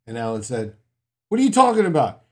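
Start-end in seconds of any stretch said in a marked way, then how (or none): 1.3-2.2 high, loud protest; old man loudly denying it; indignant reply. Retold or imitated. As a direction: insist loudly, offended. Alan protests to residents.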